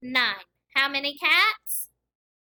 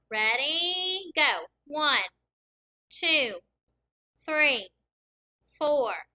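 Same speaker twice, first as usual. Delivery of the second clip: normal talking voice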